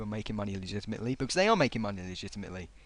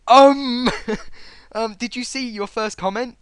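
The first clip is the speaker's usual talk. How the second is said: loud, high incredulous "um"; big mocking hesitation; exaggerated disbelief; 10 semitones higher, 13 dB louder, slower than usual. Real, not acted. Exaggerated mock disbelief at comment.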